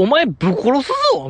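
mock threat, pitched well up; imagined fierce threat. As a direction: imitate a harsher telling-off, lightly hammed-up. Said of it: "強く言ってたと僕は思うんですよ".

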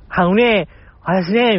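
lifted, lightly imitated young actress; 芦田愛菜 impression. Retelling a well-known impression of an actress, in light mimicry. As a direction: do a playful take on a comedian's impression. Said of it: "八代優さんの".

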